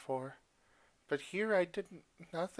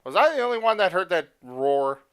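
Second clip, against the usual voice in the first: loud, fast startled outburst; startled shout; 5 semitones higher, 12 dB louder than usual. Real, not acted. Player reacting to a sudden roar, loud and fast. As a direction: blurt it out, alarmed.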